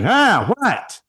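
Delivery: high, put-on voice of a hard-of-hearing husband; startled old New Yorker; elderly Brooklyn husband. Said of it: "And he's like".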